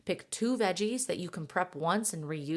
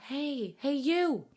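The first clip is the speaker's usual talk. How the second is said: lightly voiced body, higher, calling for attention; a hint of a pleading inner voice, higher; 6 semitones higher, 3 dB louder, slower than usual. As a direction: play the tired body nagging at mom. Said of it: "your body is whispering or yelling".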